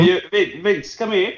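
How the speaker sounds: a hint of Vince Russo waving someone in; hammed-up booker calling Vince over; Vince Russo impersonation. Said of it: "my really, really bad, uh, Vince Russo impersonation".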